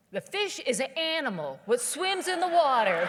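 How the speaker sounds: slow, loud, over-careful explanation. Retold or imitated. Waitress explaining fish to the snob, slow and careful. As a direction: explain it like to a child. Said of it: "kinda slow and careful so I can understand her".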